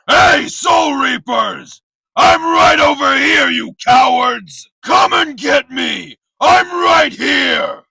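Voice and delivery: high, drawn-out challenge shout; taunting shout. Acted. Fighter daring cowardly Soul Reapers to come out, taunting shout. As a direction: call out to hidden foes, long and high. Calling out to hidden enemies.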